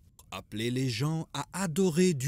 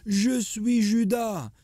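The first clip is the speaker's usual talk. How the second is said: much higher, louder quoted cry; narrated scream; 10 semitones higher, 4 dB louder than usual. A narrator's reading. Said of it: "il hurlait". (cri rapporté par le narrateur, plus aigu et plus fort)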